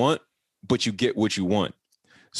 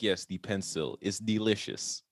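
slow, low, soft imitated foreign accent; deadpan put-on Eastern European accent; mock foreign accent; 4 semitones lower, 7 dB quieter than usual. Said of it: "You tell me what accent it is".

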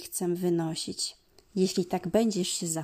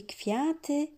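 plain reading voice

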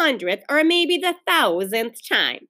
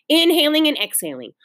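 ordinary delivery, nothing marked

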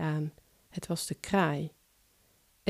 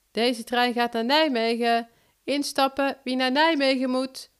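higher, louder platform announcement; conductor calling out; 7 semitones higher, 4 dB louder than usual. Acted. Conductor calling passengers aboard, loud and high. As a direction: call out to the whole platform.

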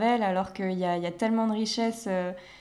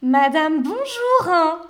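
«bonjour» swooped much higher, louder; posh sing-song greeting; 6 semitones higher, 6 dB louder, much slower than usual. Retold or imitated. Mimicking the bourgeois colleague greeting a client, sing-song. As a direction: swoop «bonjour» up like a snooty saleswoman. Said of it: "elle fait vraiment ce".

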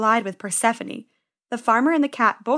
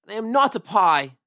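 yelling. Acted. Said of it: "he yelled".

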